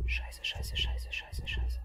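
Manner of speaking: tense whispered cursing; whispering. Real, not acted. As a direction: hiss the curses under your breath. Angespannt, Gegner unsichtbar. (angespanntes Flüstern)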